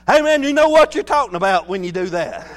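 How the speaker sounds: normal talking voice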